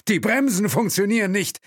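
high, loud panicked cry; terrified the brakes are dead. A narrator's reading. Chauffeur cries out in panic that the brakes fail. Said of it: "Panik in der Stimme".

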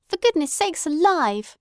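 high, dismayed exclamation; dismayed outburst. A narrator's reading. Daughter reacting to the telegram, dismayed outburst. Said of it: "said Luella".

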